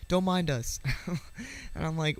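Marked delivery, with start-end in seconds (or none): 0.1-0.8 high, loud call that eases off; imitated yell. Dad calling out to strangers from the car window, yelling. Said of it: "rolls down the window and yells".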